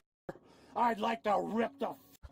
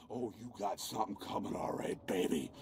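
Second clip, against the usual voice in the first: low, fast sinister growl; menacing low voice; 13 semitones lower, 6 dB quieter than usual. Real, not acted. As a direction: drop into a low threat. Menacing drop in voice.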